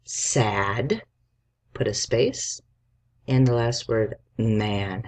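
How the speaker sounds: usual speaking voice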